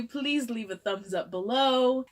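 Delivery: ordinary delivery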